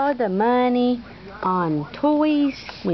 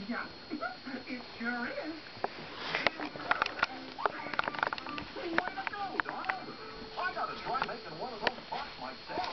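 distant, soft voice from a TV; faint TV audio in the next room; background TV; 3 semitones higher, 15 dB quieter than usual. Real, not acted. TV playing in background.

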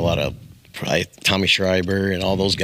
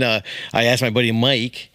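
normal talking voice

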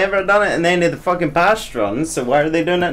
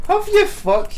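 scoffing retort; 3 dB louder than usual. Real, not acted. Scoffing at the claim.

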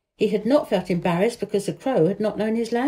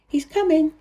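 a higher, lightly voiced young girl; girl's voice; 8 semitones higher, 3 dB louder than usual. A narrator's reading. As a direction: read Mary as an eager little girl, pitched up. Mary voiced in a story.

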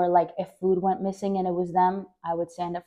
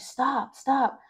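pleading; 5 semitones higher than usual. Retold or imitated. Child begging during a beating.

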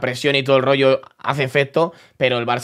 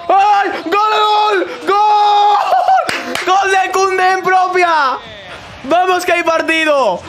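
ecstatic goal shout; 13 semitones higher, slower than usual. Real, not acted. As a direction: scream it like a fan in the stands. Celebrating an own goal.

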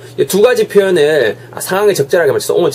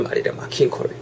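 whispered aside; 10 dB quieter than usual. Real, not acted. Whispered aside after the prompt.

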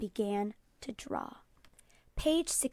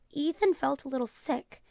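usual speaking voice

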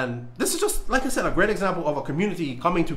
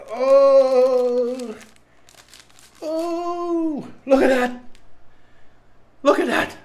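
high, loud gasps of alarm; alarmed surprise; 9 semitones higher, 3 dB louder, slower than usual. Real, not acted. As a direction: stare at the chip in shock. Looking at the chip.

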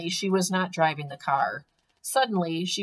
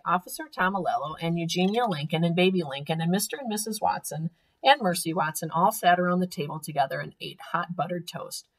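ordinary delivery

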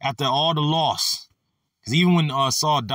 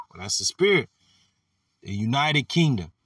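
normal talking voice

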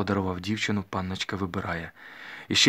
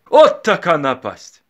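slow, high, booming exclamation; loud mock dismay; 8 semitones higher, 13 dB louder, much slower than usual. A narrator's reading. Father answering the matchmakers, loud ritual dismay. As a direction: cry out as if beset by trouble.

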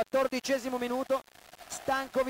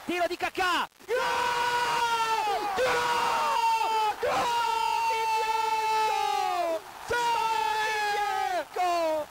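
screaming goal celebration; overjoyed partisan commentator yelling; breathless italian goal commentary; 4 semitones higher, slower than usual. Real, not acted. Kakà equaliser celebration.

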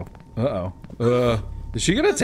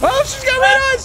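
terrified shout, higher, louder; scared horror game streamer; 12 semitones higher, 4 dB louder than usual. Real, not acted. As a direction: yell in fright. Seeing the monster.

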